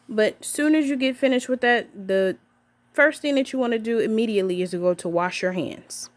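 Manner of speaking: ordinary talk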